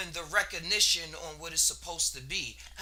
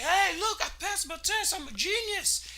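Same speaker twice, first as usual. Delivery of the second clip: put-on much higher, goofy boastful kid voice; cartoon bully bragging; 12 semitones higher than usual. The bully comes home bragging to everyone: high goofy boast. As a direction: crow about it like a dim kid who thinks he's brilliant.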